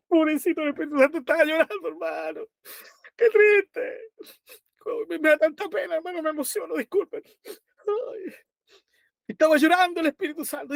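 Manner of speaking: high, mock-tearful wail, sobbing through the words; sarcastic mock crying. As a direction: ham up a weeping lament to mock the book. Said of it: "estoy siendo un poquito sarcástico".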